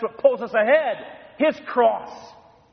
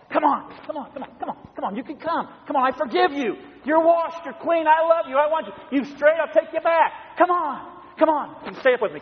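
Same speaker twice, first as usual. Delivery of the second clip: higher, pleading invitation with arms open; inviting Jesus; 8 semitones higher than usual. Retold or imitated. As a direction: call the straggler home with tender urgency. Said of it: "he looks behind always and he says".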